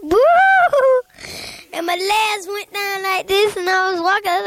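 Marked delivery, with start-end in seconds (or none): 0.1-1.0 high loud ghostly boo; spooky ghost boo. Acted. Ghost booing at the boy in the house, loud. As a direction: jump out and boo at him.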